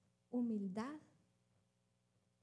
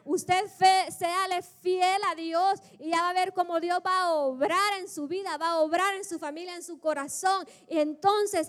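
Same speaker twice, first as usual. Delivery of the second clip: loud, driving exhortation to the congregation; fervent pulpit cry; full-force preaching; 5 semitones higher, 10 dB louder than usual. Acted. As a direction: preach it loud and urgent, raised pitch.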